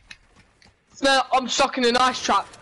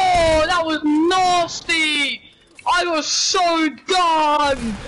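high, drawn-out gamer's complaint; real vexed outburst; 5 semitones higher, much slower than usual. Real, not acted. Player moaning to his friend after losing, vexed. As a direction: stretch the words out high in exasperation. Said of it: "I'm actually so vexed".

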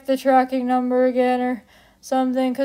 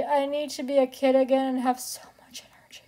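fast, soft whisper; breathless whisper; 7 dB quieter, faster than usual. Real, not acted. Wishing aloud to viewers for childhood energy, whispering. Exhausted plea.

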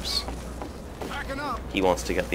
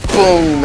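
loud high mock gunshot "boom!"; sniper boom; 9 semitones higher, 8 dB louder than usual. Telling the sniper movie, a loud mock boom. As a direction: bark the shot out. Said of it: "And I said".

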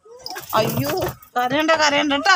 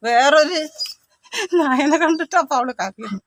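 ordinary talk

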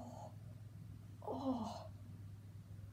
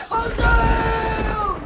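loud, higher spell shout; magic shout; 4 semitones higher, 7 dB louder than usual. Acted. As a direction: blast the magic word out. Genie casts the spell.

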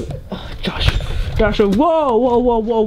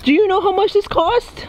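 higher-pitched, insistent question; exasperated demand; 6 semitones higher than usual. Real, not acted. Demands the other admit the cost, exasperated. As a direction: press the question with pitch climbing. Repeats question, more heated.

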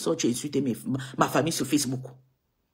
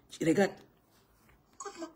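normal talking voice

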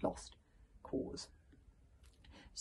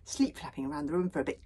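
ordinary delivery

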